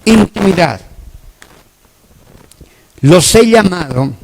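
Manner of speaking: loud, insistent preaching; full-force preaching. Acted. Preacher urging the congregation to know God, loud and forceful. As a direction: preach it out to the whole room, loud and driving.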